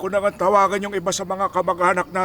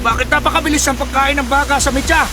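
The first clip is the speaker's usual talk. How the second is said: alarmed call; 6 semitones higher, 5 dB louder than usual. Acted. Father calls out alarmed.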